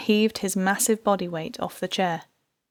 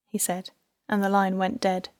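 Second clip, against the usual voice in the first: usual speaking voice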